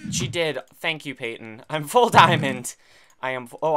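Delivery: ordinary talk, nothing marked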